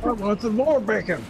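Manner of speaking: excited dog voice. Retold or imitated.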